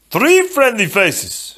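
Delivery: high, loud, stretched-out delight; delighted Fezzik. A narrator's reading. Fezzik finds his friends, delighted and loud. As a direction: exclaim it slowly in happy amazement.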